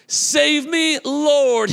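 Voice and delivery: high cry for help; shouted plea. Retold or imitated. Peter sinking in the waves, crying high for help. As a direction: call out like you're sinking. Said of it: "he shouted".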